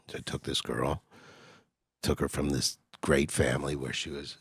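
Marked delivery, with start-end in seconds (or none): none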